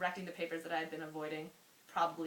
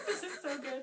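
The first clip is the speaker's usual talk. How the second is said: laughing; 11 semitones higher, 6 dB louder than usual. Real, not acted. Laughing at own writing.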